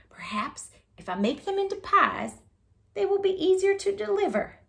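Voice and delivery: lightly voiced old woman annoyed; fussy old woman. A narrator's reading. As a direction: grumble like a busy old lady. Old woman fussing over pies.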